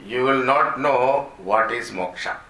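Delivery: normal talking voice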